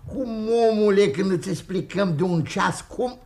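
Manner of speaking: high, loud burst of exasperation; exasperated. Acted. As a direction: snap at someone who won't understand. Annoyed, has explained for an hour.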